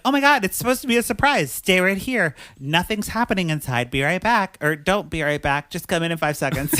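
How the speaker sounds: high, loud, hurried retold panic; flustered cover-up. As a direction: improvise frantically to keep a surprise secret. Retold flustered woman hiding surprise party.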